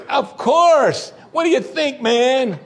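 very high, loud hammed-up retort; exaggerated, incredulous comeback; mock indignation. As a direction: exclaim it as if it were obvious. Imagined cocky reply to the king.

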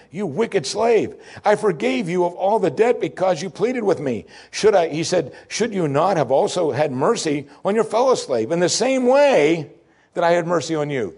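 high, slow outraged rebuke; indignant king rebuking his servant; angry lord. Acted. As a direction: scold him like a furious master. Angry lord rebukes slave in parable.

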